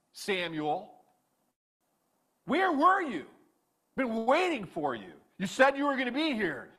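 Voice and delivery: high, loud indignant king snapping back; angry retort. Acted. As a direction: fire back at the prophet, offended and loud. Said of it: "did he respond in anger".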